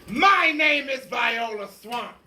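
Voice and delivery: a scratchy, scary teacher introducing herself slowly. Acted. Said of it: "said a lady in a scratchy voice".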